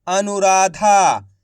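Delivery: Anuradha said whole, slowly and clearly; careful teaching read of Anuradha; slow blended word. Shown as a demonstration. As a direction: join the sounds into Anuradha. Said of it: "तो मिलके बन जाएगा".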